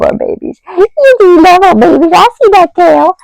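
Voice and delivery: high, loud doting pet voice. Real, not acted. Talking to her wiggling dog.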